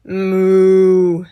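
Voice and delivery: low drawn-out moo; cow moo. Shown as a demonstration. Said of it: "what does a cow say?".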